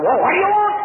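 loud, high, irritated snap; grumpy merchant losing patience; crabby shopkeeper. Acted. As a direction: bark it at her, fed up. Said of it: "he was all crabbed".

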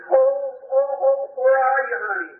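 reenacted frantic searching call; retold screaming. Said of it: "I started screaming top of my voice".

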